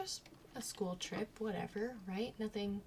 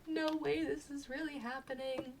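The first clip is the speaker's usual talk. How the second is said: higher, disbelieving panic; mock panic; 5 semitones higher than usual. Hitting turbulence, higher panicked disbelief. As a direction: freak out at the turbulence. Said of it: "Freaked out".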